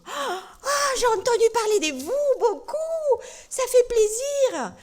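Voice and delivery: high, delighted little puppet voice. Acted. Puppet Tolo meets the church, high delighted voice. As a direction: play the teddy bear meeting the church. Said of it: "Ça, c'est Tolo".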